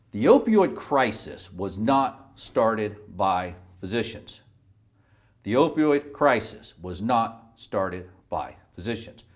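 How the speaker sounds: slow, deliberate, word by word; emphatic repetition. Real, not acted. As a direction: spell out the key point slowly, pausing between words. Repeats key statement for emphasis.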